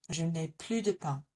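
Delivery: French plus, silent S; plus without the S. Shown as a demonstration. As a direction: say je n'ai plu de pain, no S. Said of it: "we do not pronounce the S".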